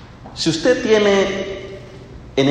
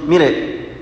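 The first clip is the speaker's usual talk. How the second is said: ordinary talk, nothing marked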